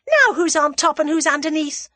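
high, loud gloating; crowing triumph. A narrator's reading. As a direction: crow over a beaten enemy. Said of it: "she crowed".

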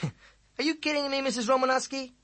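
high, loud stunned question; can't believe his ears; disbelieving boy. A narrator's reading. Boy stunned by the teacher's answer, high and loud.

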